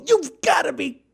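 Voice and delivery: high-pitched "are you serious" outburst; mock incredulity. Host voicing listeners' reaction to the school findings, mock incredulous. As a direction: play up exasperated disbelief, pitched well up.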